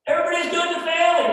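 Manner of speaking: shouted, sarcastic mock of pretended happiness; mock cheerfulness. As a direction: belt it out as a scornful parody. Mocking people who pretend all's well.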